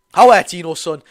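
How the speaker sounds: loud cheer for Tino; cheering shout. Real, not acted. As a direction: bellow your welcome to the sub. Fan cheers sub coming on.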